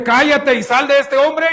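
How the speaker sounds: preacher's ringing quoted command; acted rebuke. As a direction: order the demon out forcefully. Repeats Jesus' rebuke to the demon.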